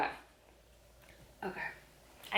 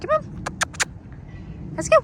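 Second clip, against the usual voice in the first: high calling. Real, not acted. Calling the dog.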